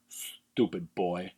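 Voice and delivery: low, slow, cold contempt; slow, low villain impression dismissing the boy; cold villain sneer. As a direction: sneer it down at the boy like a heartless officer. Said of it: "he's just like".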